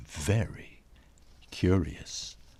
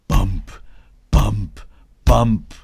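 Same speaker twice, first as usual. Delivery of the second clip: louder punched bumps; thumping heartbeat; 2 semitones higher, 8 dB louder than usual. A narrator's reading. Heartbeat sound effect.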